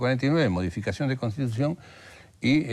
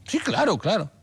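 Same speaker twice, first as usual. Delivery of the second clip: high, breezy, overconfident yes; cocky teenage self; 12 semitones higher than usual. Retold or imitated. Bluffing to the German teacher as a smug teen, high and breezy. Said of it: "mocoso, pretencioso".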